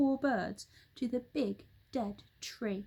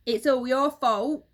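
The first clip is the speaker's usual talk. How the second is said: louder furious husband; Mister Twit yelling at his wife; angry yell; 2 semitones higher, 8 dB louder than usual. Acted. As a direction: blame the wife loudly. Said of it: "yelled Mister Twit".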